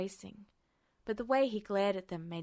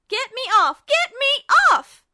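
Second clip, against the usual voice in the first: much higher, much louder screaming; panicked holler; 18 dB louder than usual. A narrator's reading. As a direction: yell it at the top of your lungs to get the bird off. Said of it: "she hollered at the top of her lungs".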